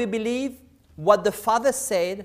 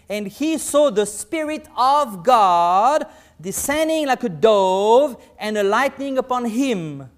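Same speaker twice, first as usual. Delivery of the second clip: scripture reading; 2 semitones higher, 6 dB louder than usual. Acted.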